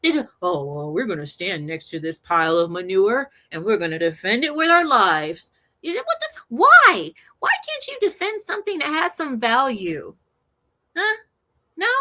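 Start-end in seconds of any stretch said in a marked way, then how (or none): 0.4-5.5 lower, put-on voice of earnest defenders; mocking defenders. Host imitating political opponents, in a mocking voice. 5.8-11.9 high, loud burst of exasperation; exasperated. Real, not acted. Host demanding answers from political opponents, exasperated. Ranting at the defenders.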